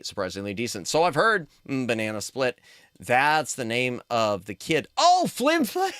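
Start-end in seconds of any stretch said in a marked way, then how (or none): 5.0-5.9 much higher, sudden Oh of realization; surprised realization. Real, not acted. Realizing to chat that Flim Flam is the kid, surprised squeal. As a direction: squeal the name as it clicks.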